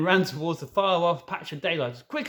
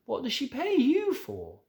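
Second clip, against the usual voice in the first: put-on high little girl voice; 11 semitones higher than usual.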